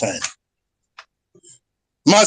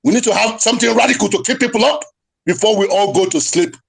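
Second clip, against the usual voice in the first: ordinary delivery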